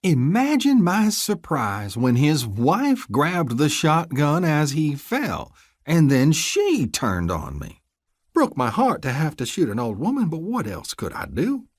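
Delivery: high, slow, sarcastic crooked lawman; mock-sorrowful deputy. Deputy rehearsing his fake story to his hostages, sarcastic. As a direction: drag out the fake regret.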